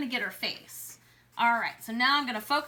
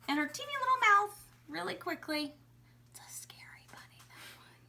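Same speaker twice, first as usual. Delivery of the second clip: whisper; 5 semitones higher than usual. Real, not acted. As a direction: keep it to a hush while drawing. Whispering after loudness worry.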